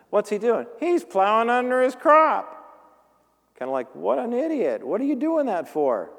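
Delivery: mock disbelief. Mocking the farmer plowing corn.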